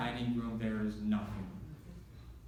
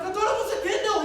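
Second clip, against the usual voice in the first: high, loud laughing shout; laughing high yell; 9 dB louder, faster than usual. Retold or imitated. Confused amid panic.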